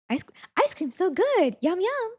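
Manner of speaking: very high, drawn-out NPC streamer voice; NPC streamer catchphrase. Retold or imitated. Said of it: "the N P C streamers".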